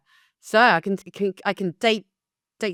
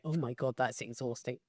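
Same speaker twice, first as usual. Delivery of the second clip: mock weary groan; 4 semitones lower, 12 dB quieter than usual. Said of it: "will be going".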